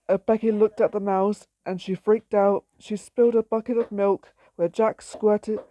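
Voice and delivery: ordinary delivery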